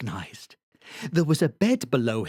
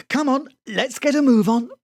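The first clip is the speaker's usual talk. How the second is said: brisk, higher, louder mum hurrying; brisk mother; 10 semitones higher, 5 dB louder than usual. A narrator's reading. Mummy hurrying Sebastian in the morning, brisk and louder. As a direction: chivvy him out of bed.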